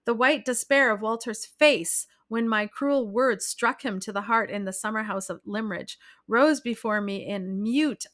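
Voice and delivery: ordinary delivery, nothing marked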